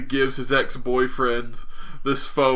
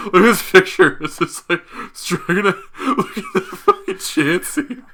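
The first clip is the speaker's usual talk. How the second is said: higher, words squeezed out through laughing; helpless real laughter; 6 semitones higher than usual. Real, not acted. Showing co-host a funny tournament picture, laughing hard. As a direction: fight to speak while cracking up.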